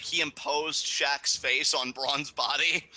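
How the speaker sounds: ordinary talk, nothing marked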